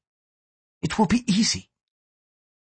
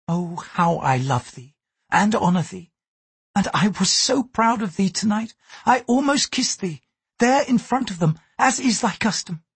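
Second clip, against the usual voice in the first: Mariko, gentle Japanese lady; 9 semitones higher than usual. A narrator's reading. Mariko warns her lover why he is guarded, higher, earnest. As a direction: speak up in a high, earnest woman's register.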